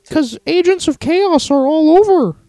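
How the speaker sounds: very high mock-alarmed caricature; high put-on voice sounding a warning; Jordan Peterson. Host finishes co-host's line as the professor, high caricature. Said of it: "like Jordan P".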